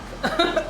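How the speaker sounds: short laugh. Real, not acted. Still laughing at herself.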